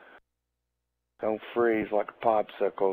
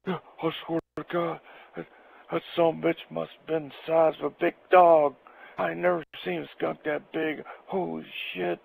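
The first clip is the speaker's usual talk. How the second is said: raised, worked-up buddy voice; voicing Jack; 7 semitones higher than usual. Jack describing the huge skunk, an excited, raised voice. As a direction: spill it like a shaken friend.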